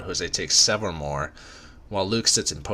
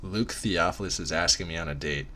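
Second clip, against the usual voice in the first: ordinary delivery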